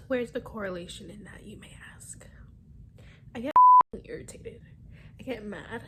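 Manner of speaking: hushed tearful whisper. Real, not acted. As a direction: drop to a whisper. Tearful aside, voice dropping.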